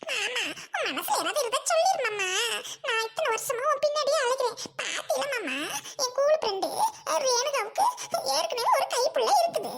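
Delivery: high, whiny sobbing plea; wailing reproach to a reluctant sweetheart; tearful pleading. Acted. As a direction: plead through hammed-up sobs. Tearful pleading with her beloved uncle.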